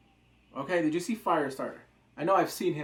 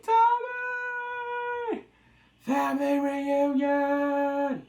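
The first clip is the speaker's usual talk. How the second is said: excited call; 11 semitones higher, 6 dB louder, much slower than usual. Retold or imitated. Joel greets his brother.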